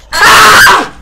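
loud high scream. Real, not acted. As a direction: shriek in terror. Caught in horror game.

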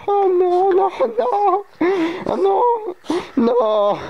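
playful fake terror, rising to a shriek; mock panic. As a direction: pretend the cat has captured you, shrieking. Mock panic, cat holding him.

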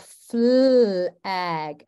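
"flag" with a long held "flll" before "ag"; "flag" split as flll-ag, slow and deliberate; blend stretched out. Shown as a demonstration. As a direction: draw out the fl of "flag" slowly, teacher modelling. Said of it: "let's think about flag".

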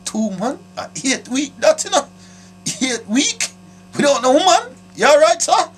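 high, rising mock shock; hammed-up astonishment at the letter; mock disbelief. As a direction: pretend outrage at two months. Mock outrage at listener.

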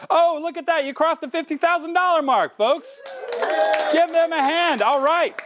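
high, bright announcement of the goal; excited. Real, not acted. Host announcing the fifty thousand goal, excited. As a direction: celebrate crossing the mark.

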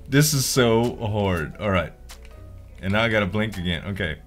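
normal talking voice

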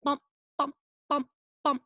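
bouncy "bump" beats; ball bouncing. Shown as a demonstration. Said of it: "dribbling his basketball".

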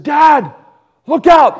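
panicked shout. Retold or imitated. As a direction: yell a warning at dad in alarm. Boy sees tree across creek.

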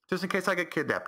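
high-pitched imitation of mom; mocking mom voice. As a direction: mimic a mother justifying herself. Mocking mom's excuse for sharing.

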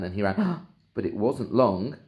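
usual speaking voice

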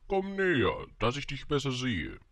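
high, loud royal summons; pompous storybook king; commanding king. A narrator's reading. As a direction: summon a subject from the throne, starting high. King summons his new subject.

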